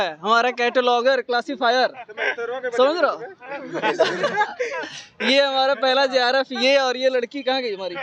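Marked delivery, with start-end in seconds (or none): 3.2-5.2 a real, high laugh that fades out; burst of laughter. Real, not acted. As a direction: laugh openly at your friends. Friends introduced, laughs.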